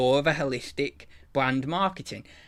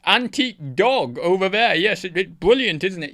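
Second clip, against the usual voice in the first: higher, louder plummy toff; posh man calling wife; 4 semitones higher, 5 dB louder than usual. Retold or imitated. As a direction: brag loudly to the wife across the room. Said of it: "he's shouting to his wife".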